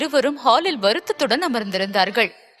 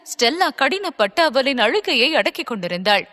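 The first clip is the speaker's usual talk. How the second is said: ordinary delivery, nothing marked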